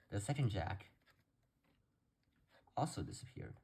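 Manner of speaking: hushed, suspenseful trick patter; theatrical magician. Acted. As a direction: let each jack vanish with dramatic pauses. Card trick patter before tutorial.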